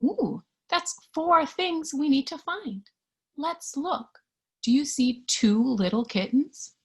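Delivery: plain reading voice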